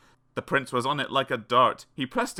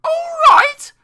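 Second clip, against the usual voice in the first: very high, indignant shout; loud high-pitched Cinderella outburst; crying out; 14 dB louder than usual. A narrator's reading. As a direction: squawk it back in disbelief. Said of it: "cried Cindy".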